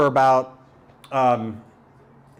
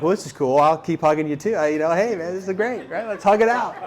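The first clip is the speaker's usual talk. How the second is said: building higher into a mock-hearty cheer; hammed-up enthusiasm; 5 semitones higher than usual. To the man who won't let go of the hug, mock-hearty enthusiasm. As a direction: play along heartily, climbing in pitch. Acts out overlong hug at summit.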